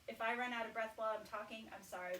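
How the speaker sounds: ordinary talk, nothing marked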